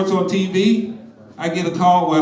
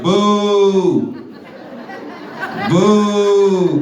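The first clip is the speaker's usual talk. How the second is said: boo them from the tree line; heckling boos; 3 semitones higher than usual. Pretend. Heckling reenactors from wood line.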